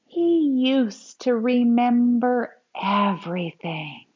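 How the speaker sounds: put-on lively, expressive read-aloud for kids; storybook reading. Teacher reading a grandpa story to children, expressive read-aloud. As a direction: read it like a picture book to a class.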